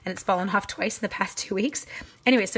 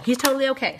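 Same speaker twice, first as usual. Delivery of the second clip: lighthearted; 5 semitones higher, 5 dB louder, faster than usual. Real, not acted. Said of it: "I will sound all lighthearted and silly".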